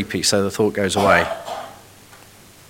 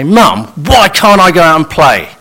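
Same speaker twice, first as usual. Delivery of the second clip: put-on whiny accusing child; 6 semitones higher, 7 dB louder than usual. Shown as a demonstration. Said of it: "The first question is a looking for wisdom".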